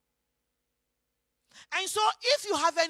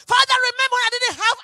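loud, high plea to the Father; forceful prayer; 4 semitones higher, 8 dB louder than usual. Acted. Preacher voicing a giver's prayer, loud insistent plea. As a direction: cry it out insistently.

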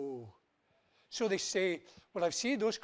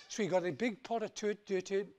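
usual speaking voice

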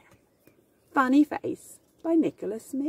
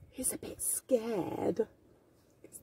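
whisper; 3 semitones lower, 10 dB quieter than usual. Acted. Scared page whispered.

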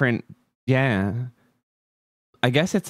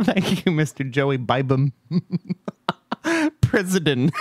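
laughing; 4 semitones higher than usual. Real, not acted. Laughing at joke donation.

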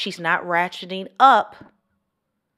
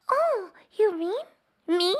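put-on young girlish voice; 12 semitones higher, much slower than usual. Shown as a demonstration. Demo for viewers, coy young girl voice. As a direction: play a bashful young girl. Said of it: "I can speak like that".

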